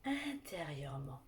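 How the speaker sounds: calm guided relaxation voice; guided meditation. Acted.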